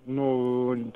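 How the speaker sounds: normal talking voice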